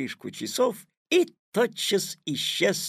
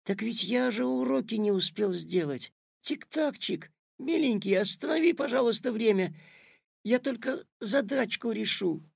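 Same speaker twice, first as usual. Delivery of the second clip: put-on high boyish wheedling; little boy begging, pitched up; pleading; 8 semitones higher than usual. A narrator's reading. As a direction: coax for a little more time. Boy begs clock for time.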